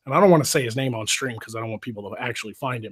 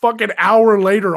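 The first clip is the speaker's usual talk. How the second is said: ordinary delivery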